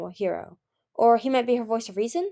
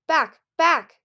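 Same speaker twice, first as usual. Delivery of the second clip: loud, high, punched single words; urgent shouted command; 8 semitones higher, 8 dB louder than usual. A narrator's reading. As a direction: order them back urgently. Urgent orders at a spreading fire.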